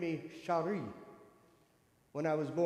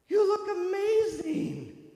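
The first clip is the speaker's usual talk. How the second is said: high, slow, breathy-sounding gush; mock gushing high praise; gushing compliment; 12 semitones higher, 8 dB louder, much slower than usual. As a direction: gush over her makeup like a saleslady.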